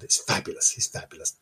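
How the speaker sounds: breathy, soft secretive aside; a hushed sales pitch to the camera; mock whisper. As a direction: whisper it like a conspiratorial tip. Whispered tourist plug.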